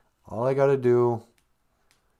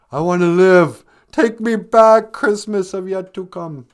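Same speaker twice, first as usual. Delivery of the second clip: pleading; 7 semitones higher, 8 dB louder than usual. Retold or imitated. Pleading with the ghost.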